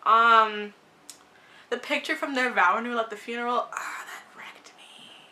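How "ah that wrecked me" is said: slow, soft whisper; real hushed whisper, touched; whispered emotion. Real, not acted. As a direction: breathe it out, moved. Emotional moment in her notes.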